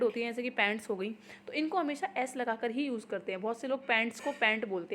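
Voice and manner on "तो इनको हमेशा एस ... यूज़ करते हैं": ordinary talk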